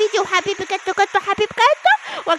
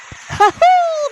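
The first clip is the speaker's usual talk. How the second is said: evil cackle; 10 semitones higher than usual. Acted. Grandma's villain laugh.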